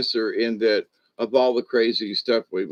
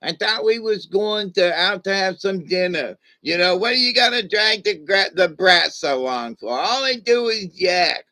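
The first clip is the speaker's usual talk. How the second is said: high, whiny, put-on old grouch complaining; grumpy old man; 8 semitones higher, 4 dB louder than usual. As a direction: grumble like a crotchety old man annoyed by a kid. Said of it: "at my age would have went".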